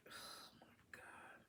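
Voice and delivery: whispered oh my God under a sigh; hushed, exasperated whisper; breathy whisper. Real, not acted. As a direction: let it slip out as a whisper. Overwhelmed sigh at the reading.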